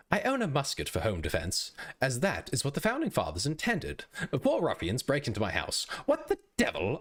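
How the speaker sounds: British colonial gent in mock British; British accent. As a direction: play the musket owner in a put-on British accent. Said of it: "I don't know if I can do British".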